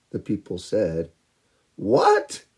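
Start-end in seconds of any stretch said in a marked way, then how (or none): 1.8-2.4 mocking disbelief. People hear of flood.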